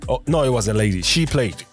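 ordinary delivery

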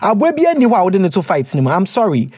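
usual speaking voice

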